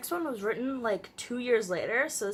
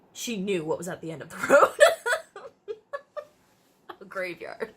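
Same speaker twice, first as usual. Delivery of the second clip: bursting into laughter mid-sentence; loud laugh breaking through the words; 6 dB louder, faster than usual. Real, not acted. Reading her old story aloud, cracking up.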